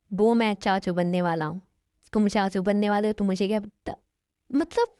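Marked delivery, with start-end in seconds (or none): 4.5-4.9 startled surprise. Acted. Sister startled by news.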